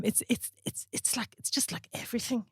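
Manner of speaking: hushed, halting, nearly whispered; imitated hesitant criticism. Videographer awkwardly critiquing her Instagram, hushed and faltering. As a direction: stumble softly, reluctant to say it.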